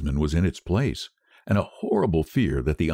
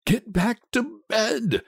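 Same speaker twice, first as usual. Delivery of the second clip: slow, higher, unnerved order; unsteady; 13 semitones higher, slower than usual. A narrator's reading. Husband orders his frantic wife to bed, unsteadily. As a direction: try to command though shaken. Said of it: "he said unsteadily".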